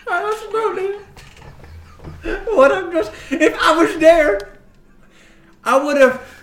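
high, loud, stumbling through laughter; laughing boast. Acted. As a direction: struggle to shout the boast while cracking up. Cracking up while voicing a loud boast.

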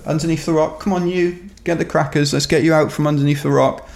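high-pitched mock coaxing. Runners to the man under the rock, high coaxing voice. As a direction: coax as if luring a stray out of hiding. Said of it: "they kind of coaxed me out".

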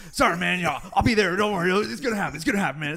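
loud, high, raspy comic impression; George. Fighter imitating his mentor promising to come, hammed-up raspy impression. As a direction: belt out a hammed-up imitation of a raspy-voiced buddy. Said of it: "like, a hilarious raspy. It's a, it's a funny voice".

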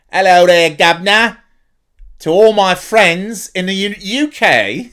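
a terrible stab at a British greeting; bad British accent. Podcaster greeting UK fans in a bad British accent. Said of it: "I've tried to do an accent and done it terribly".

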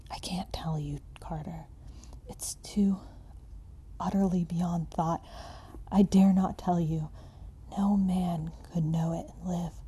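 despairing, trembling whisper; hoarse fearful whisper. Acted. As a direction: breathe it out in hopeless fear. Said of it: "still hoarse with fear, and now apparently tinged with despair".

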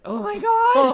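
very high, loud cry; panicked dread. Retold or imitated. Called into the office for results, a very high loud cry. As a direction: shriek in terror.

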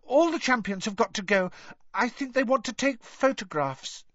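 very high, nervous squeak; anxious little boy's piping voice; nervous schoolboy. A narrator's reading. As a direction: venture it timidly to a stern teacher. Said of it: "he said nervously".

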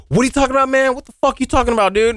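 high-pitched confrontational mock yell; worked-up, indignant challenge, pitched high; retold angry yell. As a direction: imitate an angry kid demanding an explanation. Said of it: "this kid comes out of his garage yelling at me".

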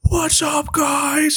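high, strained whisper-shout; whisper scream. Shown as a demonstration. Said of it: "you have to whisper scream? You have to go".

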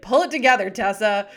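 playful imitation of the stern mother; mock stern mom. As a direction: quote the mom as a private joke. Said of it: "We'll look at each other and be like".

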